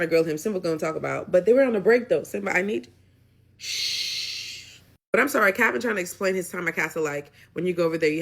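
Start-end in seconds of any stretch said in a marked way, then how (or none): 3.6-4.8 short unvoiced shush. Real, not acted. Recapper hushing Simba on screen with a short unvoiced shh. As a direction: hush someone mid-sentence with a quick shh.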